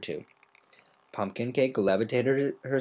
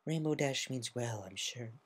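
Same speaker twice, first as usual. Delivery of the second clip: soft Fluttershy; 9 dB quieter than usual. A narrator's reading. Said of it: "she said".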